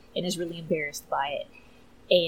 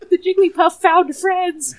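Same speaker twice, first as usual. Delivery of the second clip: high, loud, slow, laughing through tears; loud laugh-cry over a cartoon; 12 semitones higher, 12 dB louder, slower than usual. Real, not acted. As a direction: choke it out between laughs and tears.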